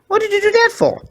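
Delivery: high pug voice, hurt squeal. A narrator's reading. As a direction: yelp it after being bitten. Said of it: "Porky squealed loudly".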